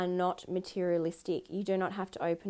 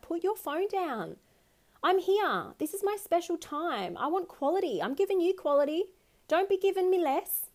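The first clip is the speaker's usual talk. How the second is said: much higher, louder indignant demands; raging at partner; 10 semitones higher, 4 dB louder than usual. Pretend. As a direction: make the partner put the phone down. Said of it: "I'm like".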